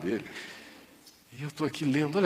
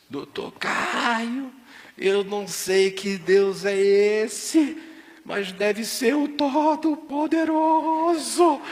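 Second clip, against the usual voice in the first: much higher, drawn out, calling loud; excited shouting; 11 semitones higher, 4 dB louder, much slower than usual. Retold or imitated. Seu Barros calls doctor Caio with the miracle news, excited shouting. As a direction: shout the news down the phone in awe. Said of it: "seu Barros gritando do lado de lá".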